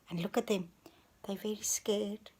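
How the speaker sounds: hushed whisper. Real, not acted. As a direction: whisper it like a secret. Scared pigs hiding.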